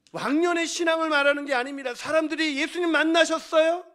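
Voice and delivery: high, very loud challenge; impassioned preaching. Real, not acted. Preacher challenges congregation about their faith, full-force shout. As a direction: press the question on the whole congregation.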